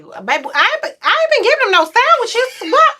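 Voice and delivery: very high, loud baffled outburst; mock panic over the sandwiches; high loud comic disbelief; bewildered alarm. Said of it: "I'm like".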